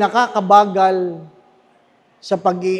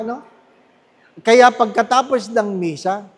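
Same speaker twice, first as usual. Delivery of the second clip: ordinary talk, nothing marked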